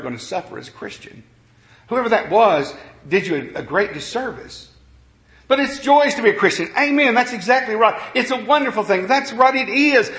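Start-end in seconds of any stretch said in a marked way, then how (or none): 5.5-10.1 higher, bright affirming praise; joyful lift. Real, not acted. Preacher rejoicing to congregation, raised and glad. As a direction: lift the room with joy.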